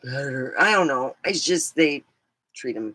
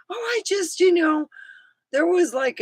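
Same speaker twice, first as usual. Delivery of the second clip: imitating son, high voice; 13 semitones higher than usual. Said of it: "He goes".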